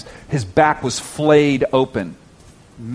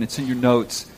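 ordinary talk

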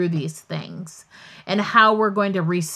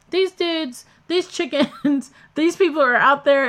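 exasperated report; 7 semitones higher, 4 dB louder than usual. Retold or imitated. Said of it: "I'm like".

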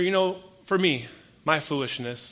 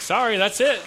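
higher and louder, playfully unapologetic; cheeky finality; 7 semitones higher, 8 dB louder than usual. Retold or imitated. Preacher telling his groaning friend that's the answer, cheeky. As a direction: shrug it off with a grin.

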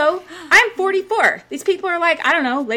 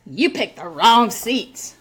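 loud, drawn-out mock complaint; retold rude complaint; 4 dB louder, much slower than usual. Woman retelling a rude fan's seat challenge, mock-pushy. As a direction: imitate a pushy fan, dragging the words out.

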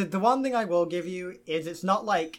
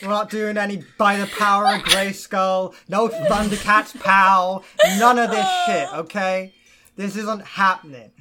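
mock rant; 3 semitones higher, 10 dB louder than usual. DM ranting mock-stern.

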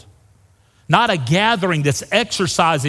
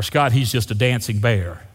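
lower, offhand brush-off; mock dismissive; 6 semitones lower than usual. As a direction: wave him away lightly.

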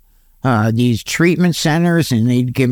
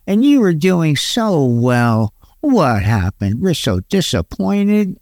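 slow, drawn-out pitying reproach; hammed-up disappointed lament; mock disappointment; 2 semitones higher, slower than usual. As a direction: stretch it out like a let-down relative. Imitating disappointed people who lectured him.

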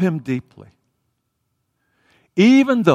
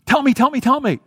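high-pitched, rapid-fire pestering; insistent questioning; 9 semitones higher than usual. Acted. Blind beggar pestering those around him, insistent questioning. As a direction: keep begging for an answer, voice pitched up. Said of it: "he just continued asking".

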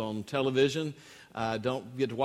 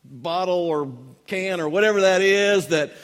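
higher, louder mock raving; gushing beer lover; 5 semitones higher, 5 dB louder than usual. Preacher imitates boyfriend on the grass, gushing about his beverage. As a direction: gush over every little detail. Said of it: "he's going on about".

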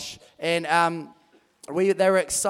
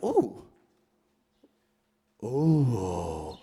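nervous ooh; 7 semitones lower than usual. Acted. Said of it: "he's like".

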